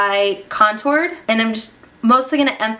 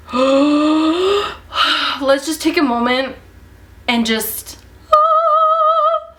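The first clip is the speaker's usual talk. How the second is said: higher, slow, swooning sigh of delight; awed gasp; 6 semitones higher, much slower than usual. Real, not acted. Vlogger swooning over her finished highlight. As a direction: melt in awe. Admiring her glowing cheek.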